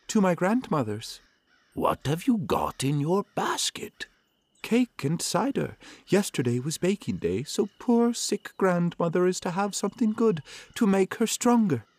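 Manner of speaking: put-on high, innocent little girl; bright child voice, pitched high. A narrator's reading. Girl answers the wolf.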